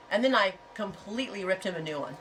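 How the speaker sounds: ordinary talk, nothing marked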